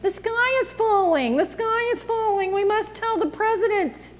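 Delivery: high, squawky panicked hen cry; clucking hen. A narrator's reading. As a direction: cluck it out like a frantic chicken.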